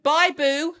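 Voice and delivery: high, loud singsong call; pointed sweet goodbye. Retold or imitated. Mum calling after daughter leaving, high singsong. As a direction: call it out with mock affection.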